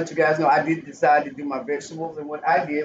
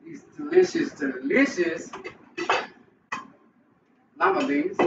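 savoring; 9 semitones higher than usual. Real, not acted. Cook dishing up lima beans for viewers, savoring. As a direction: linger on the word like a treat.

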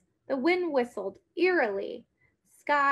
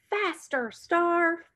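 raised call to the horse, higher; shouted; 5 semitones higher, slower than usual. A narrator's reading. Girl on horseback urging her horse on, shouting. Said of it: "and then shouted".

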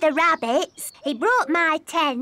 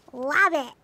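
ordinary talk, nothing marked

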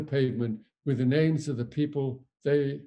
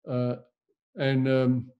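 ordinary talk

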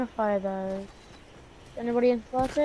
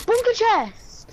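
gasping, high and thrilled; excited gasp; 13 semitones higher, 4 dB louder, much slower than usual. Real, not acted. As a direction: shout it out in delight. Spots a loot chest.

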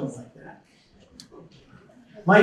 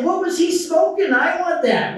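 mock amazement; 12 semitones higher, 4 dB louder than usual. Imitating impressed outsiders.

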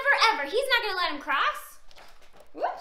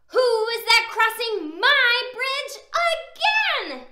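troll's yell; 6 semitones higher, 8 dB louder than usual. A narrator's reading. Troll challenging the second goat on his bridge, yelling. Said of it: "yelled Mister Troll".

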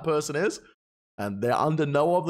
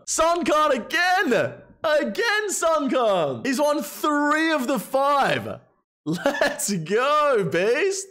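high, slow, hyped call of the winner; much higher, drawn-out shout of disbelief; excited announcement; 10 semitones higher, much slower than usual. Real, not acted. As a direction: cheer the name out like a commentator.

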